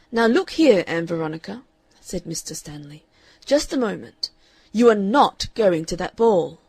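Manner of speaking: loud, stern father laying down the law. A narrator's reading. Father forbidding his daughter the ball, loud and stern. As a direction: forbid her flatly and loudly.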